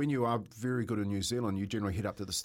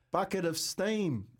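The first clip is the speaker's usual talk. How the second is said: drawn-out, higher mock-innocent repeat; slow earnest repeat; 8 semitones higher, slower than usual. Young apprentice repeats the prank request slowly to boss. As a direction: spell out the request slowly like a naive kid. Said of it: "And I went".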